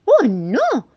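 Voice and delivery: high, loud mouse dismay; dismayed exclamation. Acted. Mouse realises he has eaten half the moon, dismayed exclamation. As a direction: cry out in alarm at your mistake.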